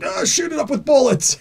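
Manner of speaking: high-pitched action-hero holler; hammed-up cry while firing at a monster; mock battle cry. Acting heroes firing at monster.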